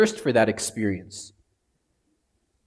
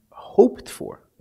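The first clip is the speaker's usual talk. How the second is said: high, airy whisper; 8 semitones higher than usual. Real, not acted. Preacher completing the verse, whispered. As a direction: breathe the words out. Finishing scripture phrase.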